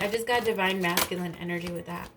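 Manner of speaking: ordinary talk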